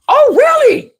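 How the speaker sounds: high-pitched mock "lady" voice; irritating woman. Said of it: "voice to people that irritate me".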